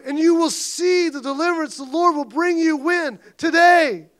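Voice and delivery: high, loud preached command; fervent preaching. Real, not acted. Pastor urging his church not to fear, loud. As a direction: call out to the church.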